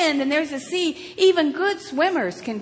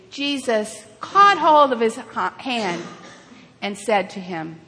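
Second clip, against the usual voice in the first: ordinary talk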